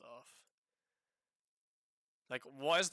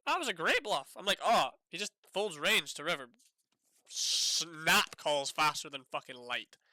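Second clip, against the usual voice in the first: loud, incredulous complaint; loud, disbelieving outburst; exasperated rant; 3 semitones higher, 11 dB louder than usual. Real, not acted. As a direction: vent at full volume about the opponent. Ranting about the instant call.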